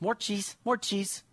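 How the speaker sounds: put-on high, soft little-girl begging; toddler voice. Dad imitating his daughter again, high toddler whine. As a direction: whine it like a small child. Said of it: "She's just".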